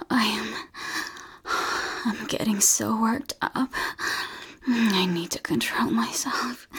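ordinary talk, nothing marked

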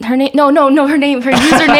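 loud laughing protest. Real, not acted.

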